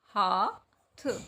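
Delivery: हाथ stretched very slowly; dictating हाथ slowly; slow dictation. Shown as a demonstration. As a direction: draw out हाथ for the class. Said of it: "तो क्या बन गया?".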